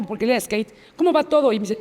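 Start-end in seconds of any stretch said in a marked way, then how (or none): 1.0-1.5 higher-pitched, breezy casual question; acting normal. Survivor hiding it from her friend, breezily casual. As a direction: imitate keeping up cheerful small talk. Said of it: "Y yo, normal, hasta sonreí".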